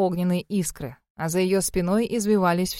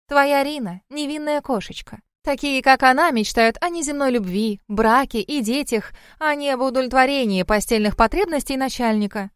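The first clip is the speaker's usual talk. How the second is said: high, teasing fox-lady voice; lightly mock him in a higher voice; amused fox woman, mocking; 6 semitones higher than usual. A narrator's reading. (насмешливая лисица, высоким голосом)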